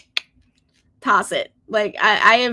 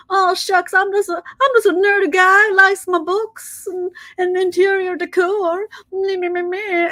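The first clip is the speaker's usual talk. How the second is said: higher, bashful aw-shucks nerd voice; mock shy nerd; 7 semitones higher than usual. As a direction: play a coy nerd fishing for sympathy. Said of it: "who's kind of coming off and says".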